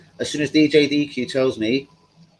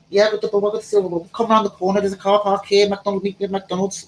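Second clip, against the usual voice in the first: high, Scouse-tinged Manchester impression; mock Manchester accent; 8 semitones higher than usual. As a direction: do a hammed-up Manchester dealer giving directions. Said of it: "Manchester voice, obviously".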